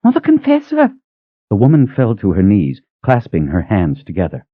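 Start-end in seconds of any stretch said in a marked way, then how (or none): 0.0-0.9 much higher, gasping cry of recognition; high elderly woman's voice, stunned; astonished old cook. Acted. Old cook recognizing the Mother Confessor, high astonished cry.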